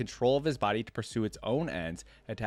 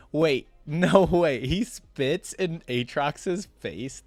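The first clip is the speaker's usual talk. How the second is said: slow, louder, laughing disbelief; surprised laugh; 5 semitones higher, 6 dB louder, much slower than usual. Real, not acted. Reacting to Pantheon spitting in Aatrox's face, laughing disbelief. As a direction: blurt it out, amazed and amused.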